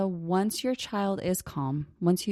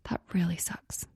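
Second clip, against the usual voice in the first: put-on gentle whisper to a hurting child; soft whisper; 4 dB quieter than usual. Shown as a demonstration. Parent softly naming a lost friendship, whispered. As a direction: say it hushed, all breath.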